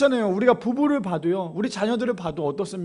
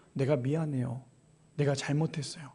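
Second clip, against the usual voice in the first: soft confession; 6 semitones lower, 9 dB quieter than usual. Acted. Forgiven person confessing.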